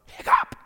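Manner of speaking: explosive mock hiccup; exaggerated imitated hiccup; wild loud hiccup. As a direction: burst out with a sudden hiccup. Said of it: "I let out a wild and loud".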